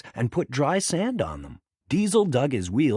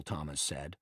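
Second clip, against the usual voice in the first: plain reading voice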